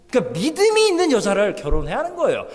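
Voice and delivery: normal talking voice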